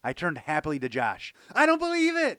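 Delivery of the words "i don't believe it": high, loud cry of joy; happy cry. A narrator's reading. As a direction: burst out in delighted disbelief. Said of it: "I cried".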